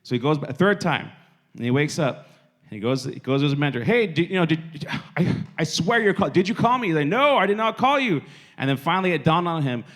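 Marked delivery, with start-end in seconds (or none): 3.8-6.9 high, loud, stammering protest; acted insistence. Samuel asking his mentor a third time, worked up and insistent. 7.1-8.2 high, loud, emphatic denial; acted exasperation. Mentor answering Samuel the third time, exasperated.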